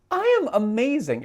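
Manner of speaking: high, loud self-hype; mock self-hype. Affirming herself in the mirror, high and loud. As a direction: shout it like a pep talk to yourself. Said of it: "At least I was saying".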